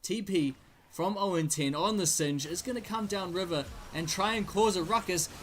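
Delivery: ordinary talk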